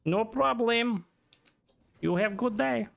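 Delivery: high, slow mock Russian accent; Russian accent for a playful goodbye; put-on Russian accent. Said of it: "when I do that accent".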